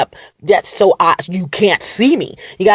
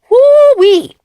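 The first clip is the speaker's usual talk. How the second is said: excited whoop; 8 dB louder than usual. Real, not acted.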